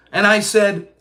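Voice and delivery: ordinary talk, nothing marked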